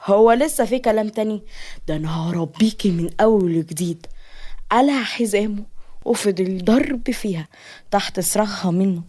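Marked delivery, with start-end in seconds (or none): none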